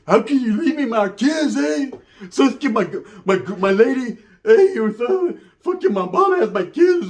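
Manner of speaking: high, slow, pleading yell with tears; pleading ex-con. Retold or imitated. Ex-con yells at his ex over his kids, high, slow and pleading. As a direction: beg her to return like a heartbroken ex-con.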